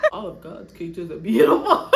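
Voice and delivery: loud laugh, then a giggly line. Real, not acted. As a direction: laugh it off, apologising.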